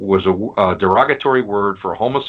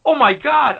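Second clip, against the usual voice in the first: much higher, thrilled and amazed; amazed gasp; 4 dB louder than usual. Retold or imitated. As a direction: gasp it out in delighted disbelief. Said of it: "you're like".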